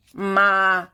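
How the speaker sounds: loud bleating goat call; a loud goat's ma. A narrator's reading. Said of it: "said Goat".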